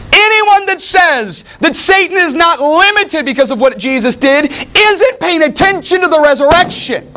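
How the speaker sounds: fiery preacher voice; preaching shout. Real, not acted. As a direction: drive it home to the congregation. Preaching at full force.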